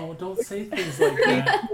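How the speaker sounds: laughing protest; half-laughing, pleading no. Real, not acted. Laughing protest at crude joke.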